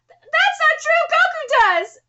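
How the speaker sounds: high, insistent character voice; indignant protest. Acted. Defending fighting to make friends, high and indignant. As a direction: argue back in a high pitch.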